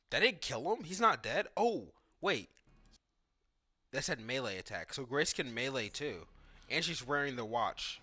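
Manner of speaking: ordinary talk, nothing marked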